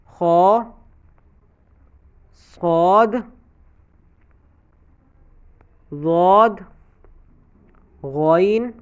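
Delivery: خا صاد ضاد غین قا قاف را each said full and heavy, one by one with pauses; heavy letters recited. Shown as a demonstration. Tajweed teacher demonstrates the heavy letters to students, each one full and heavy. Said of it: "وہ کون کون سے ہیں؟".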